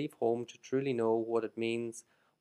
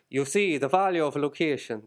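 put-on a failed Baltimore accent that comes out Irish, louder; mock Baltimore accent from an Irish speaker, raised voice; attempted Baltimore accent; 4 semitones higher, 6 dB louder than usual. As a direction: try a Baltimore lad's accent but slip into Irish. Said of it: "you can have a six-foot-three, uh, Baltimore lad's accent".

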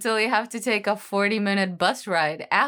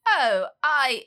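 offended mock British accent, climbing very high; hammed-up British indignation, squeaky; 10 semitones higher than usual. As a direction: play affronted in a fake British accent. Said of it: "Did you never notice my accent before?".

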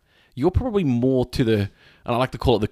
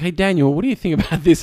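imitating a relative, higher and louder; lightly put-on higher voice, seeking advice; eager relative asking; 8 semitones higher, 5 dB louder than usual. Quoting relatives asking for medical advice.

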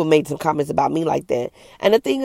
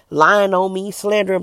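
ordinary delivery

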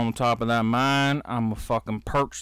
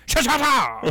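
high yelled comic-book punches; cartoon punch sounds; 10 semitones higher, slower than usual. Friends joking about hero brawl, yelled punch noises.

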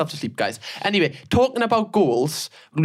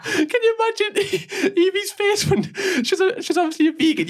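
high, slow and laughing; 14 semitones higher than usual. Real, not acted. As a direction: crack up while asking. Laughing at the idea.

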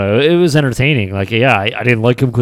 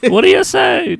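Piper impression; 4 dB louder than usual. Friend imitates Piper.

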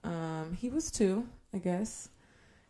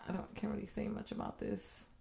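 whispering; 2 semitones higher, 13 dB quieter than usual. Real, not acted. Hushed, avoiding topic.

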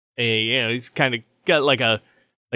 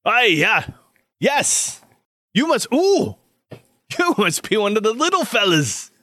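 higher, animated swooping voice; hearty armorer character; 10 semitones higher than usual. Acted. Armorer recognizing one of the little fellas, hearty and animated. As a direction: greet a newcomer with lively delight. Game master voices the surgeon.

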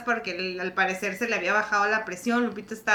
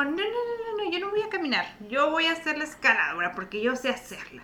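mock proud sister; 5 semitones higher, 8 dB quieter than usual. Sister voicing Lupita's proud refusal.

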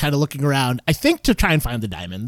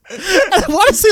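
laughing; 5 dB louder than usual. Real, not acted. Laughing while retelling.

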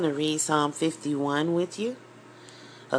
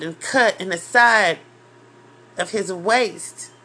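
louder, rising, fervent preaching; impassioned preaching; 3 semitones higher, 5 dB louder than usual. Real, not acted. Preacher describing the crucifixion to listeners, impassioned and loud. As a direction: preach the cross with full force.

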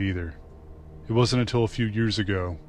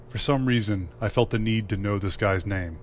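ordinary delivery, nothing marked

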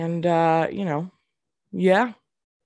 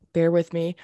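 ordinary talk, nothing marked

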